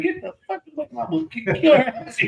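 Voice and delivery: very high-pitched mock threat; mock angry threat. Hit boy threatening the other, high mock-angry voice. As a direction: squeal out the threat in playful retelling.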